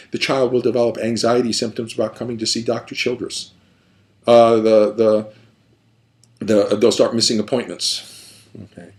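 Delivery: ordinary talk, nothing marked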